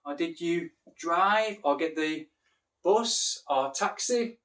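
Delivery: exaggerated teacher voice, pausing before key words; teacher's questioning voice. Shown as a demonstration. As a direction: spell it out slowly for learners. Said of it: "use some miming, like saying".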